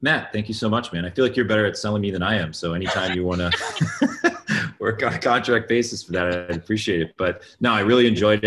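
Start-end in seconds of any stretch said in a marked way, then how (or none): 3.5-4.7 short bright burst of laughter; laughing. Real, not acted. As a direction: laugh off the compliment. Laughs at compliment on selling.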